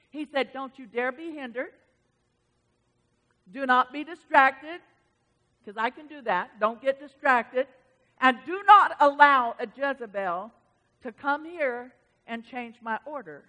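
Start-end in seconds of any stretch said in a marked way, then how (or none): 0.5-4.8 firm retold order, building louder; stern command. God instructing the pastor, stern and emphatic. 6.6-13.4 loud, emphatic retold order, easing at the end; stern command. God forbidding changes to his order, stern and forceful. Quoting God's stern instruction to her.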